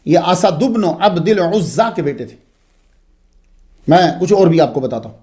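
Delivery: normal talking voice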